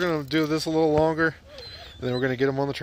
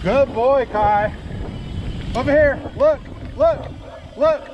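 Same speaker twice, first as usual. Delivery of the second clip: calling from far away; 8 semitones higher, slower than usual. Real, not acted. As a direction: call out to the running dog. Calling dog on trail.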